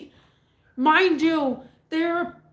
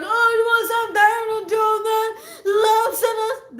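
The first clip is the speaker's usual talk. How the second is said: very high, loud put-on woman's shriek; hammed-up shrieking woman; screeching lady; 9 semitones higher, 6 dB louder than usual. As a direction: rant in a squeaky falsetto. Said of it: "then she's like".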